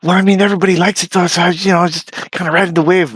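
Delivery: high mocking voice of the other guy; mocking quote. Retelling a rival's reply, mock high whiny voice. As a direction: mimic a smug whiny reply.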